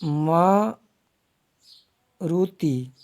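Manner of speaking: मारुति drawn out very slowly, long मा, a pause, then रुति; syllable-by-syllable demo. Shown as a demonstration. Teacher showing students the correct spelling, मारुति very slow and split. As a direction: stretch मारुति into slow separate parts. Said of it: "इस प्रकार देखना".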